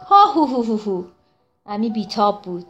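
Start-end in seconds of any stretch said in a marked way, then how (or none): 0.1-1.0 mock hearty ho-ho-ho chuckle; jolly ho-ho laugh. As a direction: chuckle like a proud old rascal. Krato laughs at his own joke.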